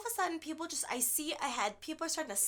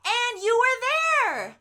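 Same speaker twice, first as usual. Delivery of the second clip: high, loud and slow, pointing at him; sudden thrilled outburst; excited; 12 semitones higher, 11 dB louder, slower than usual. Real, not acted. Excited call-out to the host.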